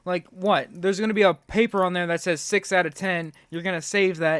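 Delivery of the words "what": ordinary talk, nothing marked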